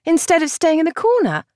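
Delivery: light, coaxing sister voice; young woman voice. A narrator's reading. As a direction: voice the gentle sister. Cassandra voiced in audiobook.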